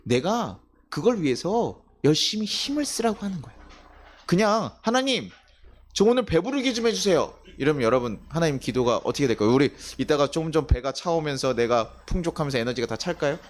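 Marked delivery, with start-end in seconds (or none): 4.8-7.4 mock pleading prayer.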